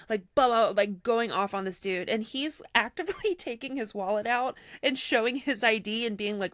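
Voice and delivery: ordinary talk, nothing marked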